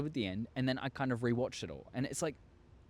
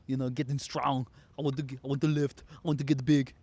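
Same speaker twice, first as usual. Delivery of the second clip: a light muscleman bark, a little louder; lightly voiced gym hero; Arnold impression; 4 dB louder than usual. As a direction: puff up and boast like a bodybuilder, half-hearted. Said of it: "Best Arnold impression you've ever heard".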